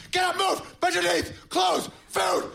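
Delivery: high, shouted drill sergeant orders; barked orders. Retold or imitated. Dad waking at the alarm, shouting high orders to move. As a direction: bark rapid commands like under fire. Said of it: "he just goes".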